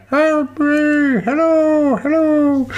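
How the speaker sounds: high far-off call from down a well; distant cry for help. Retold or imitated. Podcaster mimics trapped man calling from a well, high faint cry. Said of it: "you can hear him going".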